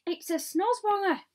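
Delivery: high, loud exclamation; alarmed cry. A narrator's reading. Police chief crying out at the creature, alarmed cry. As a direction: cry out the monster's name. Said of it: "cried the chief of police".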